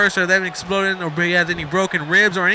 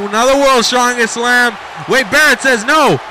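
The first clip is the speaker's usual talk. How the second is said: higher, louder wrestling call; excited play-by-play; 6 semitones higher, 4 dB louder than usual. Real, not acted. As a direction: call the fightback with urgency. Commentator calls a resisted slam.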